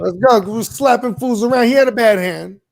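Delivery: loud, hyped-up sports fan; excited cheer. Real, not acted. Host hears the player is upgraded, loud excited cheering. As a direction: celebrate loudly, punching the words. Cheering good fantasy football news.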